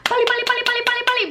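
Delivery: high, hurried mock Korean chant of pali; mock rushed stylists. Stylists rushing the model, high hurried mock call. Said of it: "you could tell they were visibly like".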